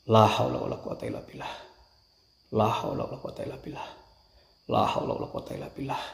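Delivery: whispered prayer. Real, not acted.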